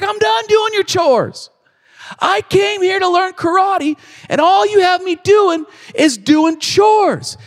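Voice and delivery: put-on a teen losing patience, yelling; frustrated outburst. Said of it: "Daniel freaks out".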